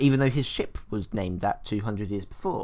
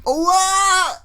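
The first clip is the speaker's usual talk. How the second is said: high, loud fake gasp of shock; mock shock; 6 dB louder than usual. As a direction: overact the surprise.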